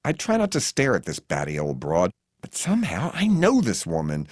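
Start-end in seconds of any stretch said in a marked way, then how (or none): none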